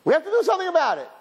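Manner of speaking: high, quick mock resolve; mock earnest. Mocking those who only talk of acting, high and fast. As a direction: parody the empty promise. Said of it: "mock hand-wringer".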